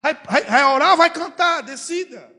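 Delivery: high, loud, impatient mock God. Preacher imagines God impatiently cutting off a praying man, in a high loud voice. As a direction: brush them off like an exasperated boss. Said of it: "Se eu fosse Deus, eu dizia".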